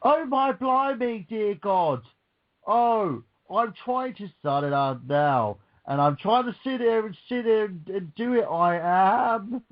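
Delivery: high, overdone mock Cockney; exaggerated imitation of a terrible English accent; bad English accent. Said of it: "try and put on an English accent and do".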